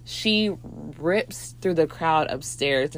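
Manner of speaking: ordinary talk, nothing marked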